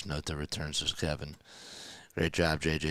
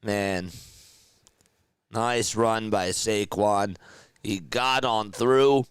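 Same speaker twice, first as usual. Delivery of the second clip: rising, louder excited sports call; play-by-play call; 7 semitones higher, 6 dB louder than usual. Real, not acted. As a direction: call the final drive live, building up.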